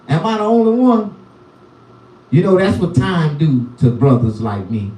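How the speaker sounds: ordinary talk, nothing marked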